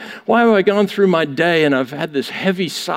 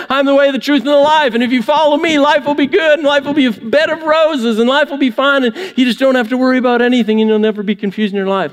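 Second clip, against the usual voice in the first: higher, rattling faster toward the end; mock promise; 6 semitones higher, faster than usual. Preacher mocking what people think God promised, quickening. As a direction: reel off the too-good promise with irony.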